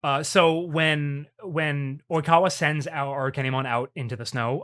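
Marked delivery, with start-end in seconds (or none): none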